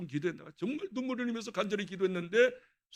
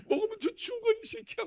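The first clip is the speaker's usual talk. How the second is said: mock scolding mother. Mother scolding him retold.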